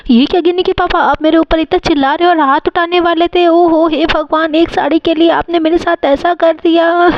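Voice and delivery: acted tearful hurt. Wife hurt by husband's scolding, tearful wailing. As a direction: protest as if breaking into tears. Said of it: "mummy कितना ज़्यादा रो रही है".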